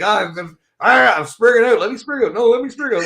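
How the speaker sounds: high, loud whiny mimicry of Johnny; mock Johnny begging to keep rambling; imitating Johnny. Host mimicking Johnny whining to be allowed to rant, high and whiny. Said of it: "he's like".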